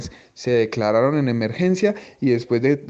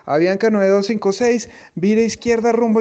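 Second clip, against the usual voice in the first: controller radio call; 8 semitones higher, 3 dB louder than usual. Retold or imitated.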